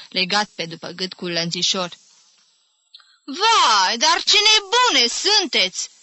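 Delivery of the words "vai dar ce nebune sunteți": high, loud, drawn-out exclamation; slow shrieked dismay; sudden outburst. A narrator's reading. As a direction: burst out appalled at the time. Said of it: "izbucni deodată constatând ora".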